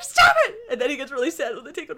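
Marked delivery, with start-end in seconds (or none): 0.0-0.6 very high, loud mock shout; mock yell. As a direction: squeal at a toddler to stop. Droids shouting at toddler.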